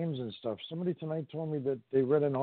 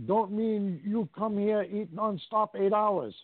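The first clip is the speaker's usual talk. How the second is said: imitated Chinese accent; 4 semitones higher than usual. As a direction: read the sign in a put-on Chinese accent. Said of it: "did you ever see that Chinese restaurant".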